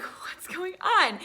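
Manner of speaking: slow, whispered bewilderment; baffled whisper. Retold or imitated. Friends confused by the crowd at barricade, baffled whisper. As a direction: whisper it slowly, totally confused.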